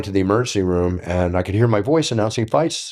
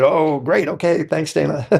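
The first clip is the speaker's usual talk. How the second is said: sarcastic thanks; 9 semitones higher than usual. Retold or imitated. Sarcastic reply to Dana's boast.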